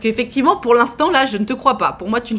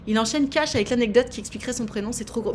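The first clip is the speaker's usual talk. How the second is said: ordinary talk, nothing marked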